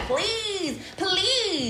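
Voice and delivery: high, drawn-out begging "please"; mock pleading. Teasing men who beg girlfriends to stay, pleading. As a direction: beg hard, stretching each word out.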